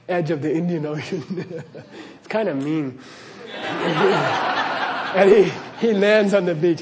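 chuckling through the telling; laughing. Real, not acted. Storyteller tickled by the frog's ocean trip, laughing. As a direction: tell it while breaking into laughter.

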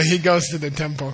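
ordinary delivery

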